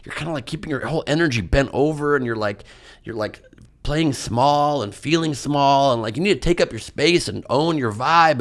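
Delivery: quoting the healer. Retold or imitated. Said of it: "He's like".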